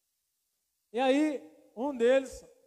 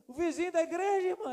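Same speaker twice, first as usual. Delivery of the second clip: higher, louder shocked question; alarmed disbelief; 7 semitones higher, 4 dB louder than usual. Retold or imitated. Pastor asking the sister if it was by the church, alarmed. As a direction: ask it aghast.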